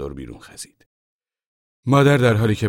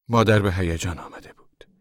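ordinary delivery

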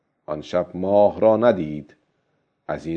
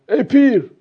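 put-on high, raised old fisherman's voice, easing toward the end; loud self-talk; 6 semitones higher than usual. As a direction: call out to yourself, urging and scolding. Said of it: "بعد با صدای بلند گفت". (با صدای بلند، خطاب به خود)

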